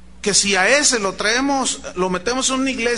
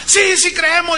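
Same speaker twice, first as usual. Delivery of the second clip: high, loud mock congregational yell; roused crowd shout; 9 semitones higher, 6 dB louder than usual. Congregation protesting they believe, roused shout. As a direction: imitate a worked-up church answering. Congregation answers pastor's challenge.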